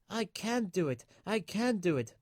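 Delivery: little engine straining up a steep hill, puffing; puffing with effort. A narrator's reading. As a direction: puff it out as you climb the hill. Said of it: "puffed Thomas".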